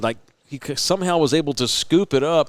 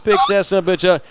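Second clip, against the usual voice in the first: ordinary delivery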